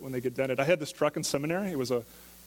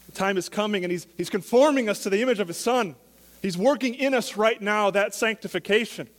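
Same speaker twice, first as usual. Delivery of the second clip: a touch higher and fuller, building conviction; rising sermon fervor; 5 semitones higher, 4 dB louder than usual. Acted. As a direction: lift the voice toward hope.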